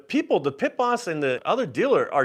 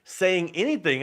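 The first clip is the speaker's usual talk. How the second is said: ordinary talk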